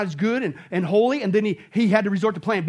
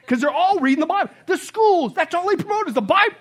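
higher, faster mock-amazed exaggeration with a laugh; sarcastic; 7 semitones higher, 5 dB louder than usual. Real, not acted. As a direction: paint an absurd fantasy tongue in cheek. Ironic fantasy, then "yeah, we wish.

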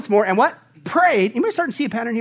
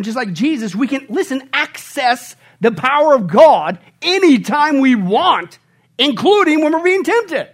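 loud, emphatic sermon delivery; forceful pulpit proclamation; full-force preaching; 5 semitones higher, 5 dB louder than usual. Real, not acted. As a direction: preach it out, raised and driving.

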